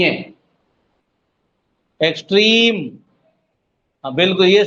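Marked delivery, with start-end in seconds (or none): 2.0-2.8 extreme with a long, held "trim"; stress on second syllable. Shown as a demonstration. Teacher showing the strongest stress, extreme leaning on "trim". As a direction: put the weight on "trim" in extreme. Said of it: "सबसे ज्यादा जोर इसमें लग रहा है".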